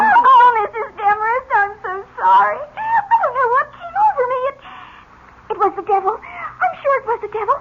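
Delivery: high, tearful, rushing excuses; sobbing apology. Acted. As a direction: cry it out to the aunt. Iris sobs blaming the devil.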